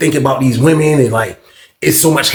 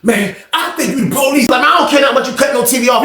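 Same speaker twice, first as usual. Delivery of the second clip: higher fast mock shouting; retold shout; 10 semitones higher than usual. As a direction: imitate the old man yelling back. Old man shouts accusation.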